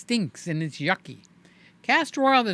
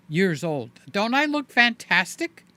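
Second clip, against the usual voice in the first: ordinary delivery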